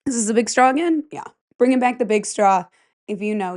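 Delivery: ordinary talk, nothing marked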